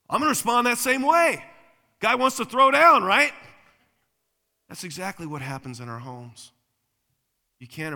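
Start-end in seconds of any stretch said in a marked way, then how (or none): 0.1-3.3 high, very loud fighting talk; hammed-up belligerent outburst; mock combative shout. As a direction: act ready for a street fight. Acting out answering a street aggressor.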